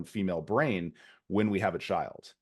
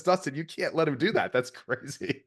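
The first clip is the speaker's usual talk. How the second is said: higher, alarmed motherly protest; horrified mom; 6 semitones higher than usual. Retold or imitated. Wife scolding husband over rough play, horrified and higher. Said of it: "she's horrified".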